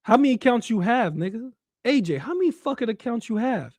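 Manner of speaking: ordinary delivery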